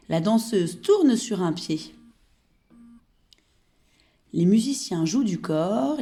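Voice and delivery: poème dit lentement, bien articulé et fort; réciter la comptine aux enfants en détachant chaque vers; récitation de comptine. Acted. Second recital of poem. (maîtresse récitant une comptine aux enfants, voix posée et forte)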